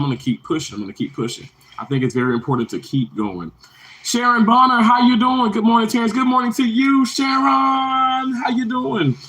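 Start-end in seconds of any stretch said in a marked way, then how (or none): 4.0-9.2 excited greeting. Real, not acted. Streamer welcoming Sharon as she joins, excited greeting. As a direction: greet them way up high, stretching the name. Greeting viewers joining the live.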